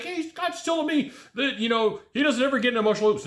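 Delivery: high-pitched put-on grumble; mock complaining viewer. Imagined viewer talking about the host's advice, mock complaining.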